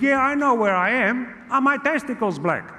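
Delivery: put-on impatient high Italian-accented uncle; Gino. Uncle insisting to his nephew in the ward, high Italian accent. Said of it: "He said".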